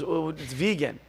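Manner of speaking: very soft, low hushed answer; barely-there murmur; retold whisper. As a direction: imitate someone quietly passing on news. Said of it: "I hear whispering, and he goes".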